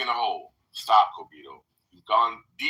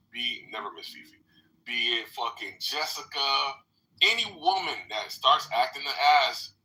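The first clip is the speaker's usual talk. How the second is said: ordinary talk, nothing marked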